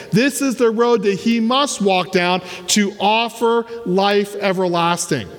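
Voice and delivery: ordinary talk, nothing marked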